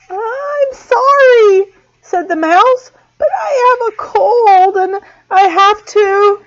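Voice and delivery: high, teary little mouse; tearful. A narrator's reading. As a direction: apologize on the verge of tears. Sick mouse about to sneeze.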